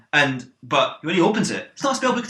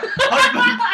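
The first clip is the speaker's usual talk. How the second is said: scolding call; 4 dB louder than usual. Acted. Mother scolding husband.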